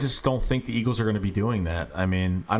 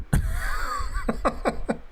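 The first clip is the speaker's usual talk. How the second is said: high-pitched laugh. Real, not acted. Laughs at his own confusion.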